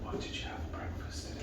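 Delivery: very soft, fully whispered question. Shown as a demonstration. Clinician testing a patient's hearing, whispering. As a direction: whisper it with no voice at all. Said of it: "Even though I'm whispering".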